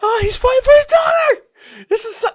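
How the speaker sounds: gasp, then very high teary cry; choked-up emotional reaction. Retold or imitated. Reliving reading the comic, very high teary cry. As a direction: voice cracking with emotion. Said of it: "But I'm like".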